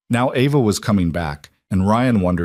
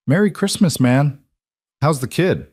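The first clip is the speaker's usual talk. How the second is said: cheerful greeting; 6 semitones higher than usual. Acted. Friend's cheerful holiday text.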